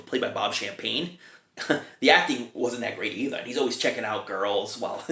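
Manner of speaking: ordinary talk, nothing marked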